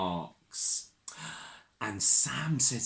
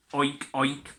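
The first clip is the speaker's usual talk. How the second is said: lightly voiced piggy oinks; mild oinking pig sounds. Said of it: "the piggy wig goes".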